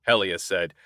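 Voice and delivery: plain reading voice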